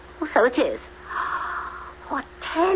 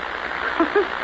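breathy scornful snicker at her; mocking snicker; 3 dB quieter than usual. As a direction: snicker at the idea. Stepsister mocks Cinderella.

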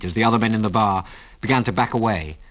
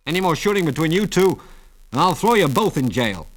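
higher-pitched constable's warning; firm declaration; 7 semitones higher, 3 dB louder than usual. A narrator's reading. Constable warns two shooters, firm declaration. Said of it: "declared the constable".

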